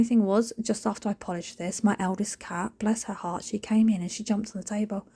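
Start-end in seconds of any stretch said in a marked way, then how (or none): none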